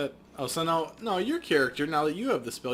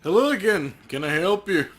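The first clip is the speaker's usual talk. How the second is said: a hint of a cheerful cop, a bit higher and louder; lightly voiced friendly officer; 6 semitones higher, 8 dB louder than usual. Officer greeting the detective, lightly voiced friendly cop. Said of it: "Officer Aguirre".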